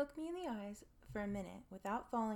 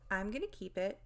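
ordinary delivery

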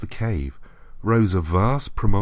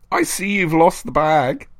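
higher taunting spirit voice; menacing voice; 7 semitones higher, 4 dB louder than usual. A narrator's reading. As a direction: taunt him from the dark. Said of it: "said the voice".

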